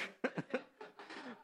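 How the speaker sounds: long, high, breathy giggle; real giggle trailing off. Real, not acted. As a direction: giggle it out softly. Laughing at his own joke.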